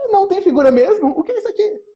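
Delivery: high, quick, amazed reading; mock surprise. Reading the picture book to kids, mock surprised. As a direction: act shocked at the blank page.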